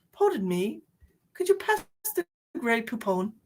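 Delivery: lightly put-on British politeness; mock-British polite request; British accent. Host playing British for viewers, mock-posh British accent. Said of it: "the British accent is just".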